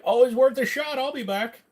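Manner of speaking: high-pitched mock voice; silly high put-on voice reading a comment; chat member's parting line. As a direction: pitch it way up, cartoonish. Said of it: "Zamaroth is like".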